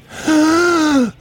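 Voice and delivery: loud high gasp for air; sharp gasp. Real, not acted. Host winded at the end of the show, loud gasp. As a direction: gulp a breath, winded. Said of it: "I'm out of breath".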